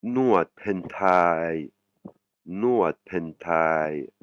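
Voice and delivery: very slow, careful nuat pentai; slow Thai demo. Shown as a demonstration. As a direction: draw out nuat pentai slowly. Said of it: "c'est le massage thaï". (nuat pentai très lentement articulé)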